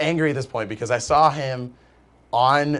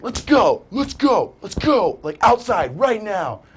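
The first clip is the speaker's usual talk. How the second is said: higher, louder taunting call; challenging to fight; 7 semitones higher, 4 dB louder, much slower than usual. Retold or imitated. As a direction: dare him outside, heated.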